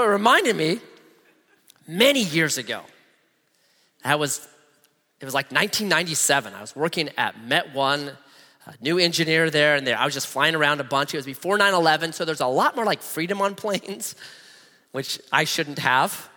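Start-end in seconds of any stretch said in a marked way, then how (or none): none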